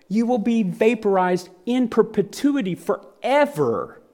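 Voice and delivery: ordinary delivery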